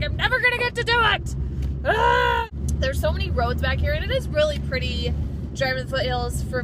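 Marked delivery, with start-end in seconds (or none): 0.0-2.5 higher, louder, fast wail; frustrated outburst. Real, not acted. To her viewers about missing Daffodil Hill, loud frustrated cry. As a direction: let the frustration burst out. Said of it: "really, truly upset about it".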